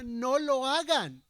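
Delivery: earnest imploring plea; pleading. Retold or imitated. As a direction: beg them, drawing out No lo hagan. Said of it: "Y les suplicaba".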